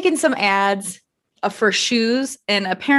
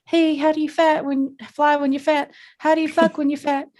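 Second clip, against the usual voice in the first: higher, put-on voice of nosy followers; mock the people in her DMs; imitating rude followers' questions in a silly put-on voice; mocking Instagram askers; 6 semitones higher than usual. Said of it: "Your voice".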